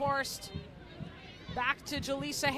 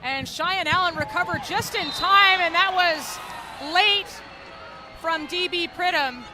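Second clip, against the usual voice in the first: excited; 6 semitones higher, 10 dB louder than usual. Real, not acted. Near chance, commentator excited.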